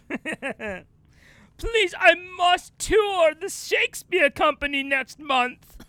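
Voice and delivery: high, emphatic thespian begging; theatrical plea. Acted. Actor begging off for his Shakespeare tour, hammy plea. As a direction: implore with grand actorly self-importance. Actor begs bear to spare him.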